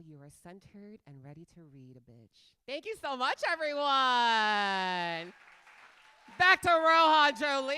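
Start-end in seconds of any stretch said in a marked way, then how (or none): 2.7-7.8 drawn-out, loud thank you; big hype handoff; loud emcee sign-off. Acted. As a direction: send it back like a stage host. Host hands off loudly.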